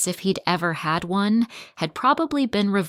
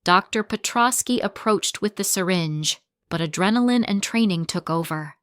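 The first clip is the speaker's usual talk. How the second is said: plain reading voice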